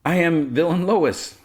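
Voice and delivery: high, slow mock horror; dramatic realization. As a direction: draw out the horrified realization, hammed-up. Lois's horrified realization.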